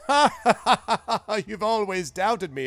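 put-on jovial wizard laughing at his old friend; hearty laugh. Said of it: "And Zephyros says".

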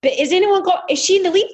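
retelling a coach screaming at the finish line; frantic yelling. Retold or imitated. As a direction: yell it like a coach who can't believe his eyes. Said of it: "he's screaming and yelling".

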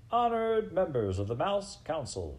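high, ringing formal address; shouting. A narrator's reading. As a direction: call out grandly to the council. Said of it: "shouted Furlow".

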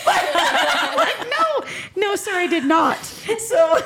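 laughing while owning up to her chemist. Real, not acted. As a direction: giggle out the sheepish answer. Laughing while retelling reply.

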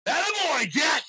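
loud, high cheering shout; screaming cheer. Retold or imitated. Viewer cheering Jack on during the film, screaming. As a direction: yell it like a rowdy viewer cheering. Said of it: "I'm just screaming".

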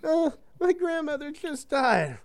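put-on high, falsetto crying girl voice; imitating the girl. Retelling the skit, a girl tells the pope, mock falsetto. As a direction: squeak it out like a sad girl. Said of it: "And this girl goes".

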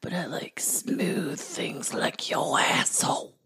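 slow, low whisper; mock seductive whisper. Parodying Anakin wooing Padme, sleazy whisper. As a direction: purr it like a sleazy lover.

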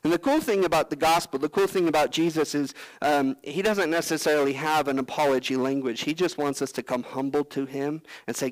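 ordinary talk, nothing marked

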